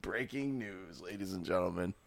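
hushed mock newsflash; mock news announcer. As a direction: announce a joke headline softly, dropping away. Repeats mock news flash, much softer.

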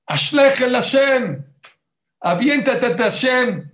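high, slow, loud exhortation; a slow preacher's shout of trust; preaching shout. Real, not acted. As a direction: cry it out to the whole congregation. Preacher exhorting full force.

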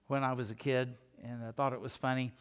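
ordinary talk, nothing marked

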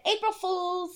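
high sing-out of a prank; spring the joke on them; teasing prank reveal. Retold or imitated. Doctor to the new mother, teasing prank reveal.

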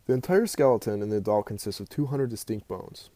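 ordinary talk, nothing marked

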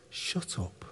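a low, unvoiced hushed shut up; hushed rebuke. God hushing the accuser, whispered rebuke. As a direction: silence the accuser with a hiss. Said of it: "God says".

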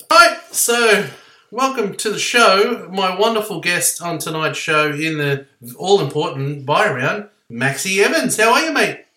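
loud, high announcer welcome for the guest; podcast host intro. Acted. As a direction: hype up the guest like a show host.

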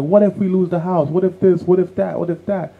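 mock fretting over every risk; worried mom. Mom worrying to dad about losing the house, mock anxious. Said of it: "She was like".

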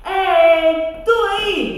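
loud high call. Acted. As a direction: shout to the dragon. (chamamento alto)

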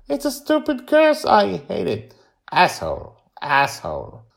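put-on high, loud child's complaint; exasperated young Joy. A narrator's reading. Girl rants about a stupid curse, high and exasperated. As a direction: complain loudly in a kid's voice.

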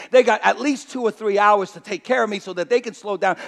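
ordinary delivery

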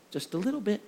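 soft deliberate mother's reply; quiet knowing mom. Retold or imitated. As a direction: let the lesson land softly.

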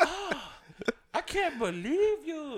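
high, drawn-out mock reproach; imitated girlfriend outrage. Girlfriend reproaching him after the podcast, mock outrage. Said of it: "she was getting on me".

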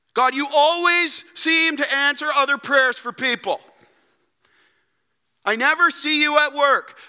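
high, loud accusing whine; complaining prayer. Acted. A believer grumbling to God about prayers, high and whiny. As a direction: complain to God like a hurt child. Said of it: "Don't we do the same thing?".

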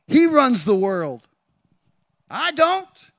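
loud, high preacher's shout; full-force preaching. Real, not acted. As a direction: shout each statement as a proclamation.

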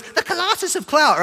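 put-on very high-pitched kid parroting; twin brother echo. Twin brother repeating the nickname, in high mock voice. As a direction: squeak out a hammed-up echo. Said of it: "then twin brother goes".

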